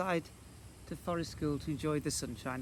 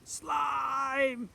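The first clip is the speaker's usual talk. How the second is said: long, high drawn-out cry; magic word shout; 11 semitones higher, 5 dB louder than usual. A narrator's reading. Saying the magic word for his friend, high drawn-out call. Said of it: "said the magic word".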